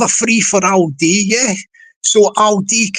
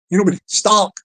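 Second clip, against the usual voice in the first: ordinary talk, nothing marked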